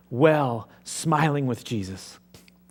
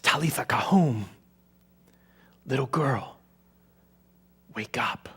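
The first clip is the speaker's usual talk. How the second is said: gentle whisper; slower than usual. Acted. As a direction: coax her awake in a whisper. Jesus raising the girl gently.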